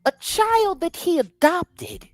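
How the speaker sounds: indignant. Real, not acted. Outraged rant about hypocrisy.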